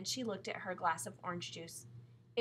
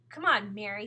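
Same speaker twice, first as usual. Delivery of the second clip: impatient call, louder; a little cross; 11 dB louder than usual. A narrator's reading. Brother hurrying his sister at the sink, a little cross. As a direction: nag with a touch of crossness. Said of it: "he said a little crossly".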